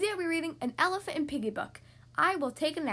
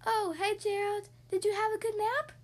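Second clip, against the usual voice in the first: curious pig; 7 semitones higher than usual. Acted. Piggy voice.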